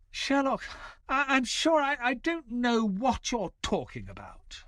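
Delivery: high, stammering, caught-out excuse; nervous high-pitched stammer; flustered denial. Acted. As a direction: stumble over the words like a guilty schoolboy.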